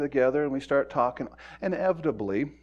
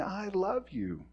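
tender; 5 semitones higher, 7 dB quieter, slower than usual. Retold or imitated. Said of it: "he first starts out saying".

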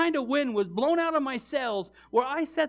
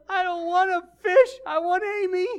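high, loud lovesick wail; mock tearful protest; 9 semitones higher, 8 dB louder than usual. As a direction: play a heartbroken teenager whining back at dad. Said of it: "my only reply I could say to him".

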